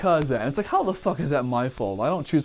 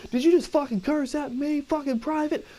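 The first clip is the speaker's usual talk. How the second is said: drill sergeant; 12 semitones higher than usual. Retold or imitated. Drill sergeant yelling at recruit.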